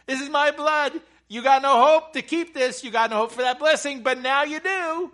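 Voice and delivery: very loud, high preacher's shout; excited loud preaching. Real, not acted. Preacher telling congregation Jesus kept the law for them, shouting excitedly. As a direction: cry it out at full pulpit force. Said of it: "Am I getting a little loud?".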